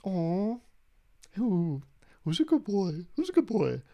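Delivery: put-on high, cooing baby-talk to a pet; dog voice. Someone talking to grandma like a pet, cooing dog voice. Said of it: "you know, the dog voice".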